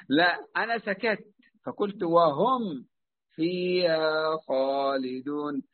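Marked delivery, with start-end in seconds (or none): 2.0-5.6 "وهم" then a stop, "فيها خالدون" slow; pause on the mim. Shown as a demonstration. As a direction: stop after the ميم of "وهم", then go on slowly. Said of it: "سكت على الميم". (سكت على ميم "وهم")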